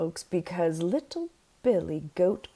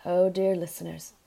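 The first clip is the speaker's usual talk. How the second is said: plain reading voice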